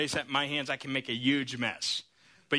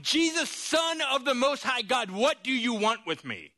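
demon screaming; 8 semitones higher than usual. Acted. Possessed man screaming at Jesus on the shore. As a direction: shriek it like a tormented demon. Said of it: "screaming out".

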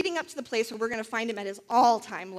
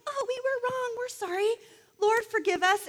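much higher, sing-song sorry; mock repentance; 10 semitones higher than usual. Ahab and Jezebel pretending to repent, high, mock-contrite. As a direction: ham up a fake apology.

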